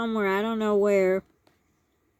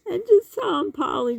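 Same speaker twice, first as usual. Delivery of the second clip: words break into a higher laugh; laughing while talking; 8 semitones higher than usual. Real, not acted. Recalling telling Polly, laughing. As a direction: giggle through the line. Laughing at her younger self.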